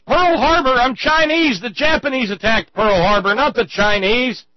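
very high, loud outraged holler. Acted. Mister Wong to Goldberg, very high outraged holler. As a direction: yell the correction at him in disbelief. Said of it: "That's when Mister Wong hollers".

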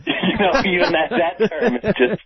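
ordinary talk, nothing marked

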